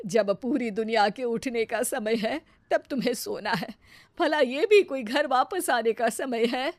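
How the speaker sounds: lightly voiced scolding elder; mild indignant reproach; reproachful mother-in-law. Mother-in-law questions bahu coming home at dawn, reproachful.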